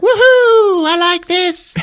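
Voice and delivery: very high whooping glee; mock excited Rey. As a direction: whoop like a kid on a ride. Rey spinning in gun chair.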